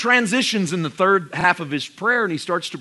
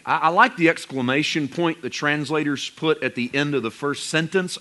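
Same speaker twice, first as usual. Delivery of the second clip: ordinary delivery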